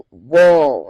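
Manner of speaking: loud surprised whoa; a startled gasp of whoa; blurted reader reaction. Shown as a demonstration. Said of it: "if I say".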